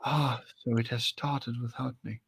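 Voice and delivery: measured British villain voice; dark character voice with a British accent. Acted. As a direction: put on a theatrical British accent. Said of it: "British accent into that".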